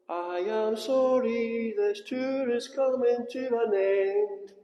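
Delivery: slow announcer-style apology; hammed-up tour guide closing announcement; mock tour guide. Mock announcement to other tourists.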